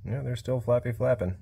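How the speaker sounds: ordinary delivery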